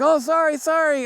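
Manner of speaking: high, squeaky mock apology; sheepish young guys. College boys apologizing to the angry dad, high mock sheepish. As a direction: play the caught-out boys apologizing fast. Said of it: "And they're like".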